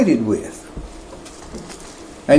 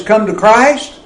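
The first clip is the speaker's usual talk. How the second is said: ordinary talk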